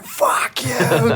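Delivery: high, strained mock yell; mock drunken cheer. Retelling a drunk leaping on a comedian's car, mock cheering. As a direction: imitate a wild holler at normal volume.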